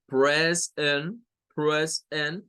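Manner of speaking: "present" with an open E, slowly; E sound. Shown as a demonstration. Teacher shows students the E sound in "present", slowly. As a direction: stretch out "present" as pres-ent. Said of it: "sonido E".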